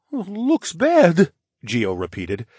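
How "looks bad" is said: put-on high, loud boyish alarm; high-pitched anxious bear cub; rising alarm. A narrator's reading. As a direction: echo it in a worried, raised voice. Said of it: "the bear's voice raised in pitch".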